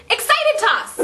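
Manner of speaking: high, eager shout of glee; acted excitement. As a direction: call it out high and thrilled as you throw. Tosses excited ball back.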